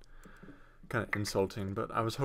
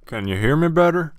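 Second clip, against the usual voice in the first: loud, higher, rising question; louder mic check; 5 semitones higher, 14 dB louder than usual. Shown as a demonstration. Streamer testing a new mic with viewers, loud. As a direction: belt it out to test the mic.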